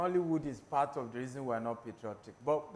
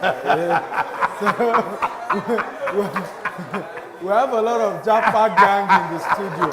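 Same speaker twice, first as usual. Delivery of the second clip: laughing; 5 semitones higher, 11 dB louder than usual. Real, not acted. Host laughing at audience hands.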